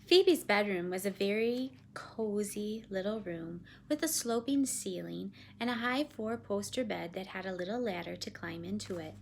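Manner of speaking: usual speaking voice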